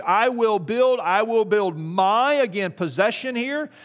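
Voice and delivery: preaching at full force. Acted.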